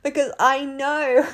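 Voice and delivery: high, giggling through the words; real laughing excitement. Real, not acted. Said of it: "I'm laughing".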